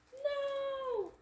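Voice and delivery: dismayed cry. Acted. Dismay at friend moving.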